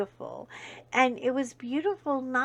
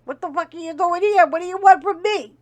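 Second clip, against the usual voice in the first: higher, louder tough New York cop yelling; Joe Pesci cop; 5 semitones higher, 9 dB louder than usual. Retold or imitated. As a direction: bark like a hot-headed Joe Pesci cop. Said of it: "He's like, Joe Pesci".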